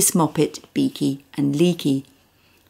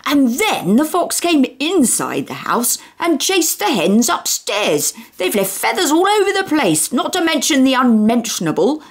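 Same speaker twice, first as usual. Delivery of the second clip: furious rant; 8 semitones higher than usual. Acted. Mister Tugg ranting.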